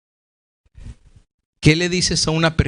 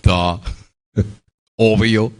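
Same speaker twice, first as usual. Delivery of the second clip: duh, obviously; much slower than usual. Retold or imitated. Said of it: "se me quedó viendo como".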